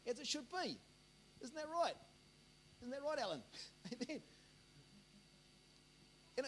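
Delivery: usual speaking voice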